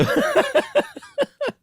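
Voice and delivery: squealing high laugh. Real, not acted. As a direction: burst into a shrill giggle. Reader laughs at joke.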